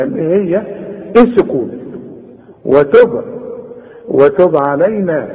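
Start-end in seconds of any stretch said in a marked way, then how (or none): 4.1-5.3 وتب with a clear qalqala bounce on the ba; وتب with a clear echo on the ba. Shown as a demonstration. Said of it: "قلقلها".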